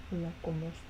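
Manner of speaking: put-on low, soft Spanish accent. Mixing the accents up while practicing, soft Spanish accent. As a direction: slip quietly into a Spanish accent. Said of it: "then I mix them up".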